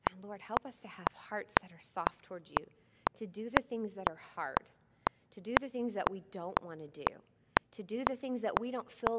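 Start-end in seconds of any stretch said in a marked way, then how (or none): 0.1-4.7 hushed prayer whisper. Real, not acted.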